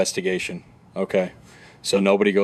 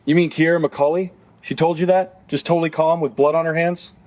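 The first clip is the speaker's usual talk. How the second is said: higher, dramatic true-crime narration; documentary narration; 4 semitones higher than usual. Acted. Narrator questioning the suspect's story for viewers, dramatic narration. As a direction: tease the next twist to the audience.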